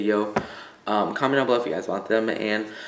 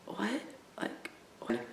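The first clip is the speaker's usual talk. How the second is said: stunned whisper; 10 semitones higher, 16 dB quieter, slower than usual. Retold or imitated. Stunned reaction to detention.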